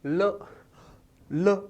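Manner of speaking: ल said clearly with the tongue touching the palate; ल with air flowing past the sides of the tongue; lateral l sound. Shown as a demonstration. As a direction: demonstrate ल slowly for the class. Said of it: "इस ध्वनि का उच्चारण करो".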